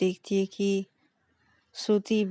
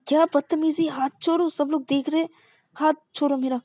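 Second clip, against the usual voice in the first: high, louder let-go-of-me; indignant protest; 8 semitones higher, 5 dB louder than usual. Acted. Embarrassed girlfriend telling her to let go in public. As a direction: snap at her, pitch high. Shreya pulls hand away.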